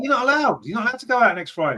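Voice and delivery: high, mock-indignant protest; mock outrage. Host forbidding co-host's Christmas do, mock outraged. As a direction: pretend to forbid a friend's night out.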